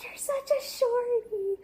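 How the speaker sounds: high, loud, slowed mocking taunt; teasing brother. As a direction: sing out the "shorty" jab up high. Brother teasing her height.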